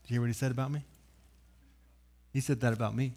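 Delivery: low, soft, lightly played self-importance; a hint of chest-puffed bragging on the quiet; puffed-up boasting. Peter bragging to those around him, mildly puffed-up and low. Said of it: "puffing his chest out, looking around".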